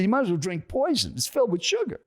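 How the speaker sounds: high-pitched mock indignation, not shouted; retold scolding. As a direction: imitate a stranger denouncing the food. Acted stranger scolding his drink.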